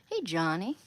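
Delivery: normal talking voice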